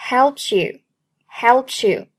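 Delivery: "helps you" run together as one word; linked pronunciation. Shown as a demonstration. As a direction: join the s of "helps" onto "you". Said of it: "Helps和you连在一起".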